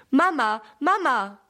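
a mild storybook cry for attention; calling out. A narrator's reading. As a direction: call out urgently, without a full scream. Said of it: "schreit Sammy".

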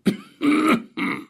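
put-on old man's cough after speaking; acted coughing. A narrator's reading. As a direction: cough as a frail old man. (gespieltes Husten eines Alten)

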